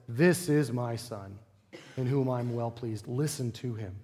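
a lightly voiced divine decree; voice of God. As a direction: speak as God from the cloud, hushed and weighty. God speaking from the cloud.

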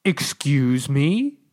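slow, drawn-out booming interruption; loud voice from above. A narrator's reading. Voice from above interrupting the diggers, loud and slow. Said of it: "The voice was loud, very loud".

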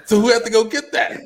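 high voice cracking into a laugh; strained laughing question. Acted. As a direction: ask it half-laughing, near breaking. Repeated rhetorical question.

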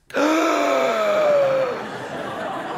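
huge, high imitated gasp; loud gasp. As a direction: suck in air like a man suddenly reviving. Imitates patient suddenly breathing again.

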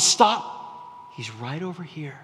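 sudden halt dropping to a hush; hushed monkey guide. Retold or imitated. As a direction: cut the chase short, then go very soft. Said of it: "the monkey comes and says".

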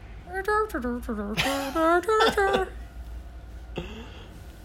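high sung Star Wars cantina melody; wordless cantina tune. Shown as a demonstration. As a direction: sing the cantina band tune without words. Hums the cantina song on cue.

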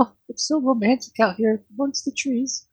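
high-pitched put-on female character; high character voice, a touch romantic; Maya. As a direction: lift the voice high for a dreamy girl. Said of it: "uh, she's like".

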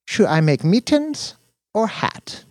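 put-on high, bright foreign girlfriend. A narrator's reading. Dina asking.

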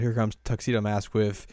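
ordinary talk

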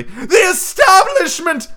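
loud, high, wavering proclamation; Professor Trelawney. A narrator's reading. Teacher naming who insults her, dramatic wavering cry. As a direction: stretch it out with huge wavering drama. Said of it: "dramatic, wavering voice".

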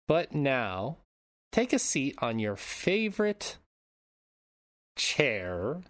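theatrical, deliberate welcome with swooping pitch; podcast host intro. Acted. Host warning listeners of spoilers before the story, showy intro voice. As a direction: draw out the key words like a storyteller. Hosts the show's scripted intro.

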